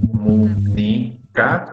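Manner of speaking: drawn-out, evenly separated syllables of "Comunica". Shown as a demonstration. Teacher counting a word's syllables for students, syllable by syllable. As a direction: count out "Comunica" slowly, one syllable at a time. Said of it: "la palabra comunicación".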